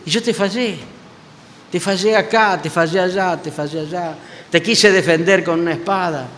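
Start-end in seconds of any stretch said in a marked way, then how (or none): 0.1-4.2 admissions trailing off softer each time; ashamed confession fading. Retold or imitated. Peter confesses his failures to the Lord, fading. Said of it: "imagino que Pedro le diría".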